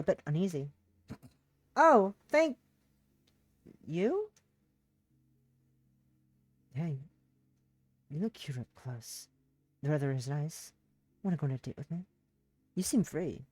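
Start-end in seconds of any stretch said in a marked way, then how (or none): 1.8-4.3 high, louder, unsure "thank you?"; thanks that turns into a question; startled thanks. Acted. Startled by creepy stranger. 6.7-13.5 lower, softer pushy stranger voice; a creep hitting on someone; low and smarmy, leaning in too close; sleazy flirt. Acted. Creepy man flirts in library.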